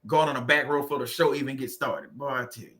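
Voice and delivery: normal talking voice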